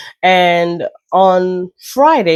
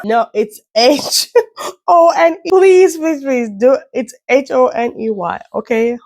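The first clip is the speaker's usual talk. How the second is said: high, laughing, loud correction; flustered laughing; 8 semitones higher, 4 dB louder than usual. Real, not acted. Vlogger hastily correcting her misspelling of honey, laughing and flustered. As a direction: plead through embarrassed laughter, much higher.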